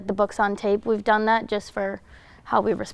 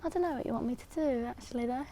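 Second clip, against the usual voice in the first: put-on British accent; 3 semitones higher, 13 dB quieter, faster than usual. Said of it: "it's British".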